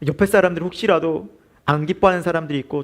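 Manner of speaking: usual speaking voice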